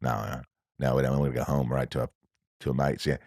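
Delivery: put-on low, hushed, quick muttering in New Zealand accent; secretive low murmur, rattled off fast; huddled in a corner. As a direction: imitate a crew conferring under their breath. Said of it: "they get in a corner and they're like".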